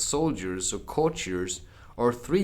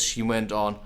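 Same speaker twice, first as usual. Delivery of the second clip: usual speaking voice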